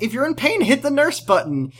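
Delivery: high-pitched exasperated mother voice; imitated nagging mom; mock scolding. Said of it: "she starts yelling at Amy again".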